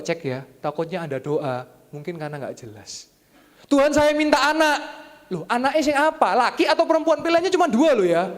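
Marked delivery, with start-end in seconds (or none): 3.7-5.1 high, slow, loud mock plea to God; a hammed-up plea for a child; mock pleading prayer. 5.3-8.3 high, loud teasing comeback; playful questioning; mock reply. Preacher voicing God's reply.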